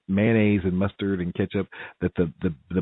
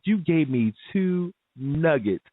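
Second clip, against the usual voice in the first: indignant, slow; 7 semitones higher, slower than usual. Retold or imitated. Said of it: "I said".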